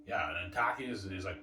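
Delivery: ordinary delivery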